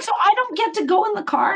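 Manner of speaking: high, fast, plaintive question; high whiny voice. Retold or imitated. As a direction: sound let down and pleading. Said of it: "She's like".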